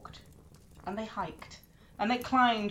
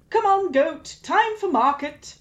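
loud, high chirpy call; cheery call; 7 semitones higher, 8 dB louder than usual. Acted. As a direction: coax the goat along brightly. Loki leading the goat.

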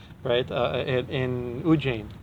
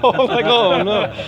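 high, drawn-out mock dismay; slow, loud groan of regret through laughter; laughing dismay; 7 semitones higher, 6 dB louder, slower than usual. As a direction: replay your dismay, laughing. Acts out own dismay, amused.

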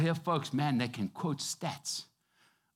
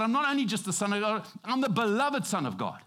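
ordinary talk

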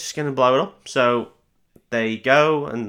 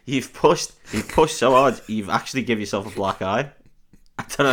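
ordinary delivery

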